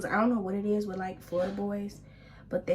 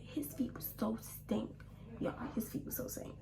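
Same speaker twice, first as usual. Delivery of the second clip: whispered aside; 9 dB quieter than usual. Real, not acted. Hushed confession to viewers.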